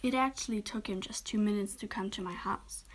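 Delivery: plain reading voice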